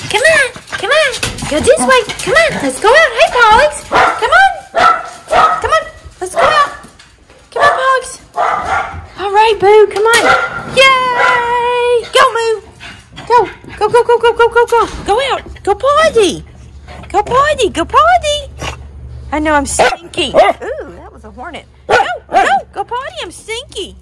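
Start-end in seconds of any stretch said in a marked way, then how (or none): 0.1-18.5 high and loud, calling the dogs out. Real, not acted. Owner calling her dogs outside, high and bright. 21.9-24.0 higher pet-talk voice; high voice for dogs. Real, not acted. Owner talking to her dogs in high pet voice.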